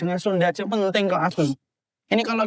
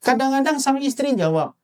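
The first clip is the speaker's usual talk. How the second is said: ordinary talk, nothing marked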